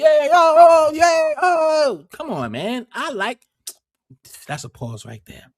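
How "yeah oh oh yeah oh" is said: mock moaning.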